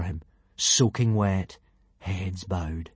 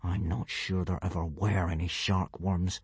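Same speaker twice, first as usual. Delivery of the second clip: low, quiet, restrained annoyance; held-in irritation, voice dropped; through gritted teeth; 3 semitones lower, 7 dB quieter than usual. A narrator's reading. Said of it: "said Hiccup through gritted teeth".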